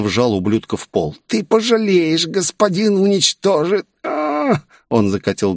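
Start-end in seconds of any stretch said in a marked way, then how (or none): 1.3-3.9 strained high wail of a beaten villain; agonised threat. Acted. Villain pinned down, threatens in agony. 4.0-4.6 very high pained wail; high, drawn-out acted "ah" of agony; final high groan of pain; cry of agony. Villain's last cry before convulsions.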